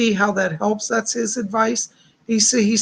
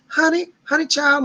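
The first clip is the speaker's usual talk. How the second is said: higher, sweetly imitated Southern accent; Ginger; 7 semitones higher than usual. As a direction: lightly put on a sweet Southern lilt. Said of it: "so sweet and Southern".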